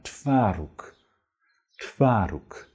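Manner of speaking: "Twaróg" with the w weakened toward f; softened w. Shown as a demonstration. Teacher showing easier way to say it. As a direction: let the w in "Twaróg" slide into a light f. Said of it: "Viel leichter".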